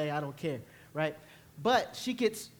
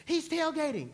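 panicked wife; 9 semitones higher than usual. Retold or imitated. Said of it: "But she was in a panic".

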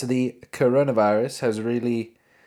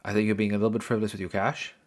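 lower, softer mock-parental lecture; stern sit-down talk; 3 semitones lower, 6 dB quieter, faster than usual. Fan lectures [name] about money, soft mock-serious. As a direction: calmly scold a spendthrift friend. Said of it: "I'd have to sit him down and go".

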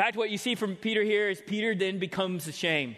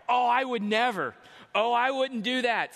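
boastful claim; 6 semitones higher, 4 dB louder than usual. Acted. Mocking boastful future self.

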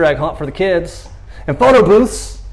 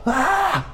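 mock scream; 11 semitones higher, 4 dB quieter than usual. Disciples freak out.

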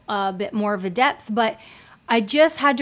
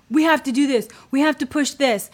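pushy teacher; 4 semitones higher than usual. Retold or imitated. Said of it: "I'm teaching Brooklyn".

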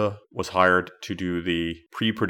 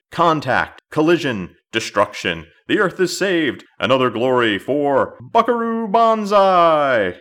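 building shouted climax, higher and louder; excited announcer; 8 semitones higher, 7 dB louder, slower than usual. Acted. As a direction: belt the finale like a cartoon announcer. Climax of read-out adventure summary.

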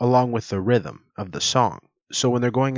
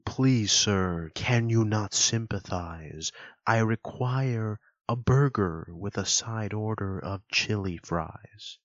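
slow, theatrical Shakespearean plea; drawn-out mock-stage delivery; Shakespearean actor; 3 dB quieter, slower than usual. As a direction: order food like a hammy Shakespearean player. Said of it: "every interaction is a Shakespearean play".

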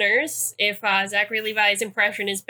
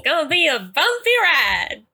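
a mild Southern Texan twang for the intro; fake Texan accent; 4 semitones higher than usual. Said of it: "we are gonna do the whole episode like this".

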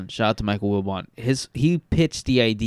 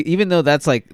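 usual speaking voice

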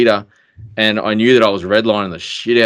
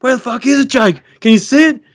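high, strained panicked shouting, re-enacted; mad panic; 4 dB louder than usual. Angler who can't see the water, mad panic. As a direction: fire off frantic questions, much higher. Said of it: "It's just a mad panic".

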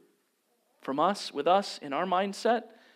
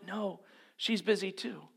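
put-on little boy voice; 4 semitones higher, 5 dB quieter, slower than usual. Boy answering phone in story.

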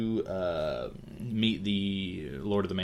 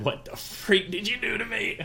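pained cry; 13 semitones higher than usual. Retold or imitated. Subaru in pain on the floor.

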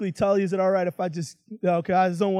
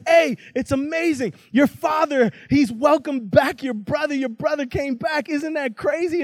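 high-pitched, thrilled news-breaking; acted excitement, pitched up throughout; excited servant; 6 semitones higher than usual. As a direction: blurt out amazing news to a friend.

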